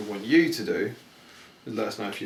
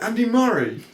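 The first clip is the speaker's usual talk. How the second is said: higher, louder called-out name; mock call out; 11 semitones higher, 5 dB louder than usual. A kid on a bike calling out to the lookalike, loud. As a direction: shout the name across the street. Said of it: "this guy went".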